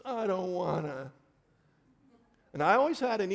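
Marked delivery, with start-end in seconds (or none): 0.0-1.1 lightly voiced kid refusing, a bit louder and higher; a hint of a protesting child; whiny child. Child balking at homework, whiny.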